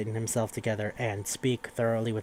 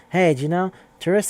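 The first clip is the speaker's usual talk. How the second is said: higher, louder teasing opener; spooky teasing; 7 semitones higher, 8 dB louder than usual. Retold or imitated. Boyfriend teasing his girlfriend about missing tourists, higher louder tease. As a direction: lean in loudly to start a creepy tale.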